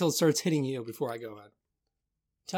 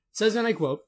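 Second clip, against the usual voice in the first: usual speaking voice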